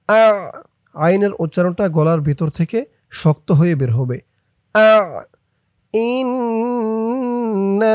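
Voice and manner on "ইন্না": ইন্না with the long stretched madd; slow chanted ইন্না; drawn-out recitation. Shown as a demonstration. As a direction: hold the vowel of ইন্না for a long count. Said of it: "মধ্যে মুনফাসিলের চিহ্ন দিয়েছে".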